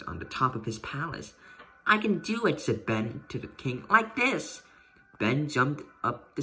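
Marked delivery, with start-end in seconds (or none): 1.8-4.6 put-on eager boy. Boy boasting to king.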